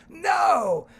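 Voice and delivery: high, loud triumphant no; kid shouting. Retold or imitated. Child answering the storyteller, loud and triumphant. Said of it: "the same kid who said snake goes".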